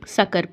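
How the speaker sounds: सकरा said as "saqar", ending on r; stopped ending. Shown as a demonstration. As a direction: drop the final vowel of सकरा. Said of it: "सकरा पढ़ेंगे".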